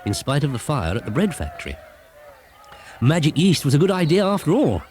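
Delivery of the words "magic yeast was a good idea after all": Broomstick's voice. Acted. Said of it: "said Broomstick".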